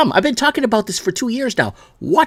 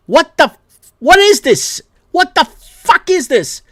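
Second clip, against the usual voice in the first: much higher, louder exasperated yell; outraged outburst; 9 semitones higher, 5 dB louder, slower than usual. Real, not acted. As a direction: shout it in disbelief, dragging the last words.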